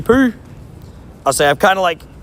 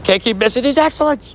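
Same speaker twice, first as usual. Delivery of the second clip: ordinary talk, nothing marked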